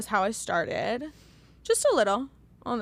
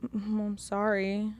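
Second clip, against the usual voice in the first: mumbled apology; 3 semitones lower, 8 dB quieter, slower than usual. Retold or imitated. Neighbour's quiet apology imitated.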